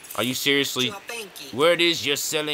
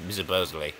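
ordinary delivery

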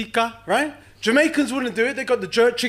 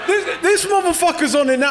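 ordinary delivery

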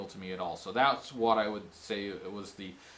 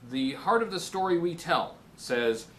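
normal talking voice

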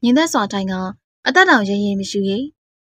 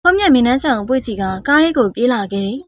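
ordinary delivery

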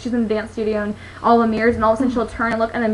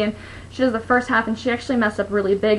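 normal talking voice

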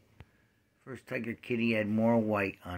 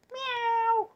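high mock meow. Imitating the cat.

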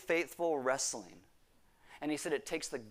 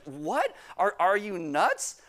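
incredulous; 3 semitones higher, much slower than usual. Retold or imitated. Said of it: "He's like".

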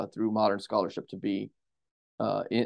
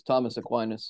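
ordinary talk

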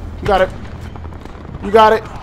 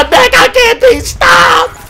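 triumphant shout; 12 semitones higher, slower than usual. Real, not acted. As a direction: roar it out like an unstoppable champion. Boasting, then repeats louder.

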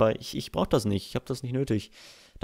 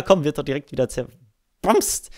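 ordinary delivery

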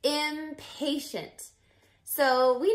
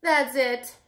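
ordinary delivery, nothing marked

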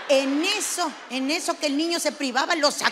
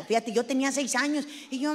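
ordinary talk

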